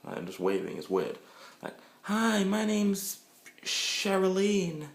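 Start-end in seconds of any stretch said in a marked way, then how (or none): 2.0-4.8 put-on high, slow girly voice; woman introducing herself at the club, high and slow; girly introduction. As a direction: play a woman drawing out her name.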